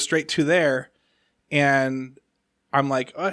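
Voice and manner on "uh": ordinary talk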